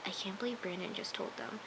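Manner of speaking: quiet aside of mock disbelief; hushed disbelief. Her hushed reaction to show.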